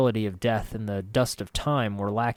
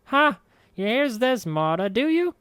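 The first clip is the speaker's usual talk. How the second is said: defiant crone; 13 semitones higher, 4 dB louder than usual. A narrator's reading. Marta defiant to ghost.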